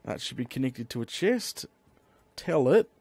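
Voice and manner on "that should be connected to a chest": ordinary talk, nothing marked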